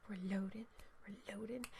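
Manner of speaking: breathy hush, barely any voice; whisper. Pretend. Streamer whispering to chat while the game loads. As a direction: whisper it like a secret.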